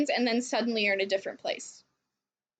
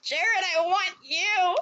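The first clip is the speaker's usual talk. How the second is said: higher, slow, hammed-up longing; mock desperate plea; 6 semitones higher, slower than usual. Telling friends her reply to Jared, mock pleading. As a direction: draw it out like a lovesick cry. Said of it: "And I'm like".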